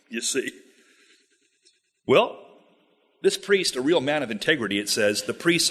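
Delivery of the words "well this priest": ordinary talk, nothing marked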